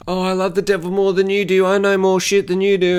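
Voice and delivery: lightly hammed-up snob; mock snooty goth. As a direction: brag like a try-hard goth kid. Mocking goth one-upmanship.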